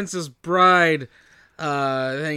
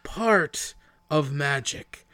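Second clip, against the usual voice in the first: slow, drawn-out pronouncement; portentous elf line, each word stretched; mock solemn; much slower than usual. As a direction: intone it slowly like a film elf.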